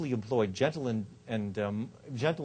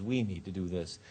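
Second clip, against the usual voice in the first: lower, softer suggestion with "We"; inclusive; 5 semitones lower, 5 dB quieter than usual. Shown as a demonstration. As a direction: bring them gently in with you. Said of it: "Much more effective is".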